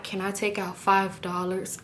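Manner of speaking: soft, slow, hushed request; discreet whisper. Retold or imitated. Customer trying to be discreet with the bank teller, whispering. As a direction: keep it low so nobody overhears. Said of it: "I'm trying to whisper to her".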